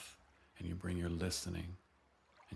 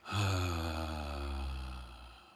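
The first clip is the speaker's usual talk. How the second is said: long audible sigh out; voiced sigh; 2 semitones lower, 4 dB louder than usual. Shown as a demonstration. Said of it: "Sighing the voice out".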